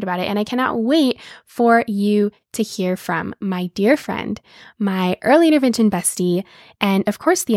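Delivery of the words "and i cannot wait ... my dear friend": ordinary delivery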